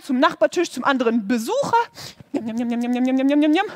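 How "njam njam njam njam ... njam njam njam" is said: loud chewing noises. Acted. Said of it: "so laut geschmatzt".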